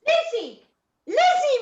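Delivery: high, loud call of the daughter's name; excited calling out. Acted. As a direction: shout for Lizzie from across the house.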